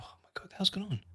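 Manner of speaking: hushed startled whisper; breathless whispered alarm, retold. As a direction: whisper it like you just heard a crash upstairs. Retelling hearing the crash.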